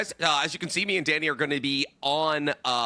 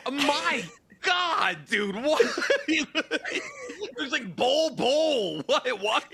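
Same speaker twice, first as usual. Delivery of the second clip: high, laughing shout of disbelief; shocked laughing; 10 semitones higher, slower than usual. Real, not acted. Shocked at tall figure.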